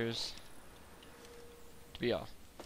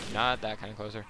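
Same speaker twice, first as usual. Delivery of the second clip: ordinary delivery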